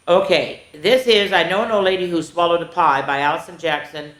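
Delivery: ordinary talk, nothing marked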